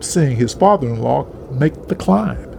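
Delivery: ordinary delivery, nothing marked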